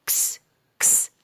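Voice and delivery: كس as a vowelless "ks"; sound of X. Shown as a demonstration. Teacher showing how X is pronounced, isolated ks. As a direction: snap k into a hissed s on كس. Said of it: "ال اكس بتنطق".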